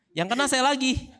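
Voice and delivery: laughing. Real, not acted. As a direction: let the laugh break the line. Husband laughs at own story.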